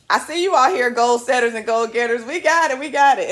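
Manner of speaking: loud, high hype call to the viewers; excited hype. Real, not acted. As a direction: pump up the crowd. Hyping up the morning audience.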